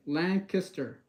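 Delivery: Lancaster as LANK-uh-ster, weak middle vowel; local pronunciation. Shown as a demonstration. Streamer repeating the locals' way for viewers, Lancaster as "LANK-ister". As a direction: punch LANK in Lancaster and swallow the rest. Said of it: "the way they pronounce it is".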